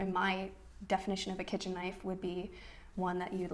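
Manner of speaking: usual speaking voice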